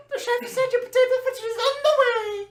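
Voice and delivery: put-on bright, squeaky young waitress delivering good news. Waitress cheerily announcing to rowdy family, high and loud. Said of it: "Jennifer comes back and gives them good news".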